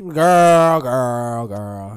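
starting loud, fading down; mock girl girl girl. Imitating a friend's excited girl then answering, fading. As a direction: call girl then let it fade.

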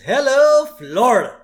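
high, loud cheery hello; cheery Homer greeting. Retold or imitated. Homer proudly greets Lisa in her costume, loud and high. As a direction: greet her like a game show host.

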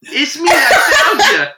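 high, silly Long Island voice; hammed-up Long Island accent. Announcing himself to mother as cake boss, mock Long Island. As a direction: ham up a Long Island accent through laughter. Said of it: "I was like".

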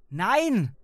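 loud, high shout; sharp yell of rejection; snapped refusal. A narrator's reading. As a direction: snap it out at full volume. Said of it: "schnauzte ich".

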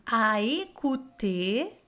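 A écouter breathed slowly, each é clear; teacher demo of French; slow French model. Shown as a demonstration. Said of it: "nós temos um passé composé".

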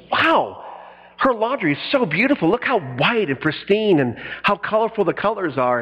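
surprised admiration. Retold or imitated. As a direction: gush lightly as if amazed. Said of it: "and says".

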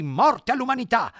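high, loud war cry; battle cry. Acted. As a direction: yell it like a charge. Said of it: "al grido di".

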